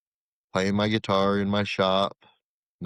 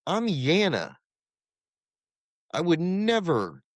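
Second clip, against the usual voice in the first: emphatic denial; 10 semitones higher than usual. Retold or imitated. Insisting he is not suicidal.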